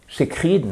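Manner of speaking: "sacred" dit sé-krid, à la française; prononciation fautive. Shown as a demonstration. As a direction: imite l'erreur francophone sur "sacred", fin en "krid". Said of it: "non, non, non". ("sacred" mal accentué, sé-KRID)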